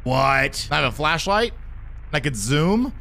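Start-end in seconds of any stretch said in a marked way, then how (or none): none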